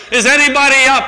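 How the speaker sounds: high, loud desperate call upward; man dangling from a branch shouting to the sky; crying out for help. A narrator's reading. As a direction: yell up from the cliff for help. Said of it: "he cries out".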